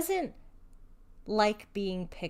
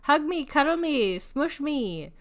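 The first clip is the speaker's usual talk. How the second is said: higher, louder pleading pet voice; needy cat voice; 6 semitones higher, 5 dB louder than usual. Pretend. Owner voicing her clingy cat begging for hugs, higher and pleading. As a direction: beg for cuddles like a clingy cat. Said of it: "my other one's like".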